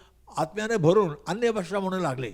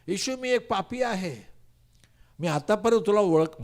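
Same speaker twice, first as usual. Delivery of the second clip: pleading cry, pitched much higher; 6 semitones higher than usual. Retold or imitated. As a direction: cry out a confession, begging. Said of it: "माझ्या आई ओरडायला लागली".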